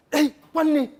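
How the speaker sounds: sudden high alarmed exclamation; Sonny, flipping out. Acted. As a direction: cut in sharply, pitch jumping up. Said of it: "Sonny flipped".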